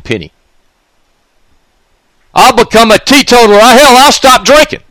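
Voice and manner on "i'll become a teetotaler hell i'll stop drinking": high, loud sarcastic yell; sarcastic hollering promise; mocking shout. Real, not acted. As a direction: bellow the deal as a joke. Mocking offer at the peak.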